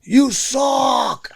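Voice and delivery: raspy heckler taunting the player; heckler's raspy yell. Retold or imitated. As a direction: bellow it like a rowdy fan in the bleachers. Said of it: "a really raspy, strong voice".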